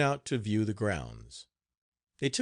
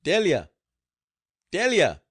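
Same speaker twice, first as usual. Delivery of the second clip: loud, high call across the house; 8 semitones higher, 9 dB louder than usual. Acted. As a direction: yell her name toward the kitchen.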